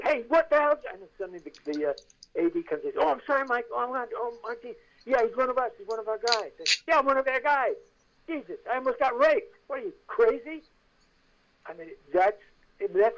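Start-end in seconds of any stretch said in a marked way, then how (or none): 0.0-0.8 high, loud retold yell for help; acted yell, pitched right up. Actor about to be locked up, yelling out. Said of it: "I yelled". 3.0-6.5 high-pitched, flustered apology; acted hurried vouching for a colleague; apologetic assistant director. A D rescuing actor from guard, flustered apology. 6.9-10.6 high-pitched, worked-up protest; acted outrage after a narrow escape; indignant outburst. Actor just saved from guard, indignant.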